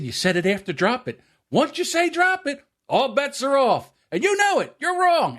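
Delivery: high-pitched heated protest, retold; mock arguing yell. Son blaming his father for the dropped dock, high indignant argument. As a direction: argue the point insistently, voice way up. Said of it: "he's yelling back and forth".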